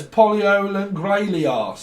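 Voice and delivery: high, loud, slow mock French; botched French accent. Correcting the singer's name, botched French accent. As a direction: stretch the name out in bad French. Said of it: "fucks up the French accent".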